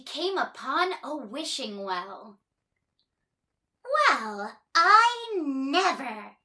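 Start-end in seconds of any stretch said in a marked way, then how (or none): 4.1-6.4 surprised exclamation. Acted. Miss Trouble stumbling on the wishing well, astonished. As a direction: draw out the amazement. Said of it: "she said".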